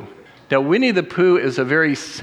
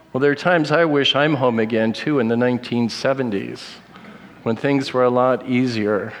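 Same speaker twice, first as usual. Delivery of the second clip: normal talking voice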